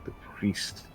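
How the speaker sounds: "The priest" breathed in an unvoiced whisper; whispered echo. Real, not acted. Player echoes incredulously.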